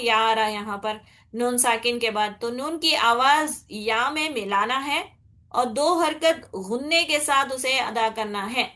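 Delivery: normal talking voice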